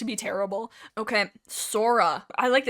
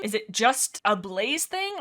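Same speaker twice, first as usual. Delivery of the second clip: usual speaking voice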